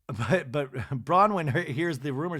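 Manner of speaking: usual speaking voice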